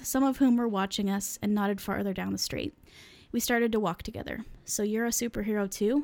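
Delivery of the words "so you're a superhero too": ordinary delivery, nothing marked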